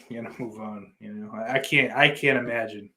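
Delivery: ordinary talk, nothing marked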